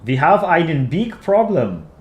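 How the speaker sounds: slow, badly faked Dutch accent; attempted Dutch accent. Shown as a demonstration. Streamer trying a requested accent, slow mock Dutch accent. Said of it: "Dutch accent, please".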